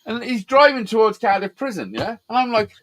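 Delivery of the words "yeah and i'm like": ordinary talk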